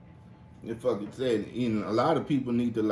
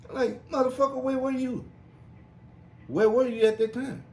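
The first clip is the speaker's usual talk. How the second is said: angry rant; 4 dB louder than usual. Acted. Ranting at absent kids.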